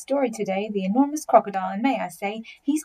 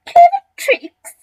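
very high, loud squealed echo; scornful cry; 14 dB louder, slower than usual. A narrator's reading. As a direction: shriek it back in mock disbelief. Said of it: "cried the not so big one".

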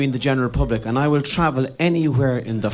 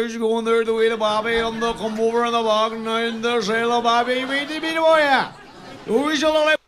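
much higher, broad mock Donegal accent; thick Donegal accent; 7 semitones higher than usual. Comedian mocking his hometown, thick Donegal accent. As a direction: ham up a thick Donegal mumble. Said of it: "That's a Donegal accent".

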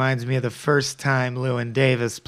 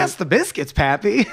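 high, lilting mock radio slogan; commercial tagline; 6 semitones higher than usual. Podcaster imagining Pappy's radio tagline, high sing-song slogan. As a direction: pitch it up like a silly ad catchphrase. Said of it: "maybe his tagline is".

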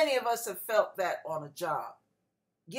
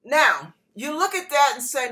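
ordinary talk

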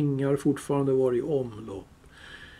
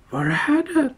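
much higher frantic creature shriek; Gollum screaming; 13 semitones higher, 4 dB louder than usual. Acted. Gollum searching for his ring, high frantic shriek. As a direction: shriek it in panic, high and wild. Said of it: "hörde Bilbo honom skrika".

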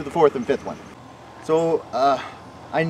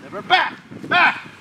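calling out to a dog; 12 semitones higher, slower than usual. Real, not acted. Calling dog commands in field.

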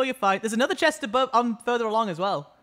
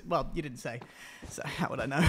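serious voice; 8 semitones lower, 10 dB quieter than usual. Shown as a demonstration. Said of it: "serious voice for".

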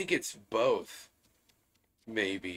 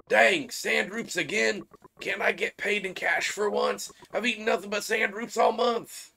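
mock complaint; 9 semitones higher than usual. Worker gripes about pay.

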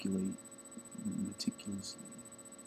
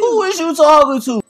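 much higher and louder, sassy; mock sassy retort; 15 dB louder than usual. Teasing a friend's slip with a loud, high sassy comeback. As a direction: throw it back with mocking attitude. Mocking the teacher's grammar slip.

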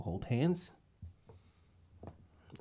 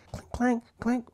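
high-pitched clinking noises; imitated cutlery clanking; 12 semitones higher, slower than usual. Acting out a dinner table, high cutlery clinks. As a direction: mimic a knife on a plate, up high. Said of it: "and knife clanking".

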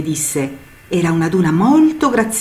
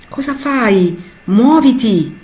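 higher, impatient shout of the wind; the wind growling at the cloud, loud and impatient; growled at; 7 semitones higher, 4 dB louder, slower than usual. Acted. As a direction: snap at the dawdling cloud to move. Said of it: "le ringhiò dietro il vento".

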